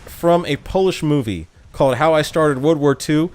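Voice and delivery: ordinary delivery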